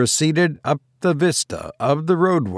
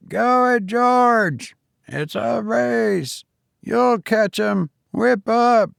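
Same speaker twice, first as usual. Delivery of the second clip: cheering crowd; 10 semitones higher, slower than usual. A narrator's reading. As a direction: egg the cab on like a crowd. Chorus cheering race.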